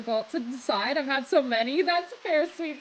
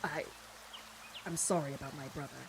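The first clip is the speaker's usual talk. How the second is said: low, soft, halting apology; deep-voiced man; 7 semitones lower, 10 dB quieter than usual. A narrator's reading. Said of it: "His deep bass singing voice".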